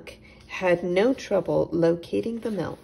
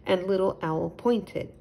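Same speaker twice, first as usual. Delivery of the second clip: usual speaking voice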